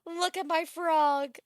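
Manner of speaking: high, loud, slow showing-off; doting, excited show-off; proud frog owner. Acted. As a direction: present your pet proudly, stretching it. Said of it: "He's just like".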